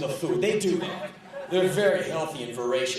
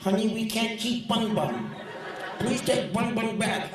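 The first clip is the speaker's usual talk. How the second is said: crazy woman voice; 8 semitones higher than usual. Retold or imitated. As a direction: mimic a dotty pet owner. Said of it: "this woman talked like this".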